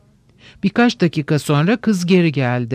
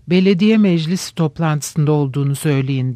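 plain reading voice

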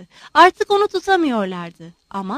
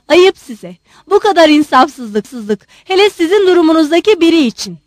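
loud, raised-voice reproach; indignant scolding; 5 semitones higher, 8 dB louder than usual. Acted. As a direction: shame him loudly. Mother scolds the general.

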